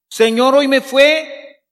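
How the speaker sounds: hurt complaint to God; complaining prayer. Shown as a demonstration. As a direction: reproach your dad for not showing up. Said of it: "A quejarnos".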